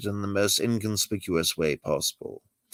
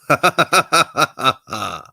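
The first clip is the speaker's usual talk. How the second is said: higher, louder burst of laughter; 4 semitones higher, 5 dB louder than usual. Acted. Catman laughing at the milk joke, loud laugh. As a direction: let a big laugh out.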